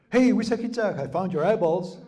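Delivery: high, loud happy call; excited squirrel call. Retold or imitated. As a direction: call out high with good news. Squirrel announces found eyes.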